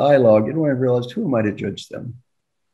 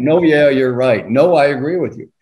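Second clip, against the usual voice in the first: louder, pushy no yeah you're right; mock eager agreement; 6 dB louder than usual. Coach mimicking a smart listener's pushy interruption, louder. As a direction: barge in to prove you already knew. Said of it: "why do we always say".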